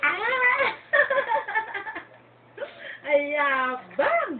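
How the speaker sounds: high laugh then a slow laughing tease; laughing, slowed teasing. Real, not acted. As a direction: laugh loud and drawl the tease. Laughing at proud baby.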